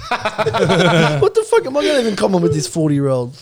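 ordinary talk, nothing marked

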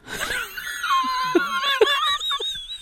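screaming, crying with laughter; shrieking laughter. Real, not acted. Podcaster shrieking with laughter at butthole tanning search. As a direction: lose it completely in a high shriek.

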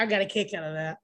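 ordinary delivery

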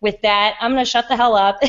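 ordinary delivery

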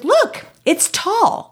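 high, slow imitation of a proud toddler; lifted kid voice, drawn out; little boy. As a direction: show it off like a small child. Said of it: "he's three years old".

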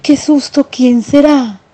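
mild mock fright in a high mouse voice; frightened mice. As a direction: squeak out a little scare. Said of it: "Estaban llenos de miedo". (ratoncitos asustados, voz aguda)

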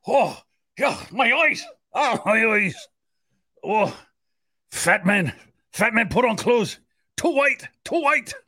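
high, shocked mock watchers; horrified spies watching. Spies watching him on the toilet, squealing in mock horror. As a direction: recoil in hammed-up disgust.

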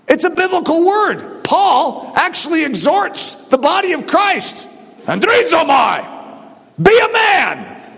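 high, shouted pulpit declaration; forceful preaching. Real, not acted. Preacher relaying Paul's exhortation, forceful shout.

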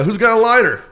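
high, loud stage call. Retold or imitated. Comic asks audience for a lighter, calling out. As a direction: throw the question out to the crowd. Comic calls out to his crowd.

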